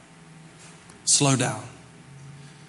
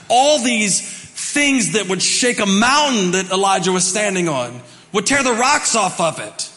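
rising, louder preacher's build; preaching at full force; 6 semitones higher, 4 dB louder than usual. Acted. Pastor to congregation, preaching with rising force. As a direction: build the fire and the earthquake to a peak.

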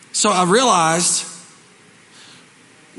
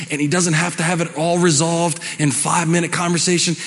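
ordinary talk

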